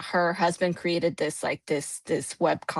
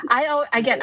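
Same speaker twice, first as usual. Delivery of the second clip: ordinary talk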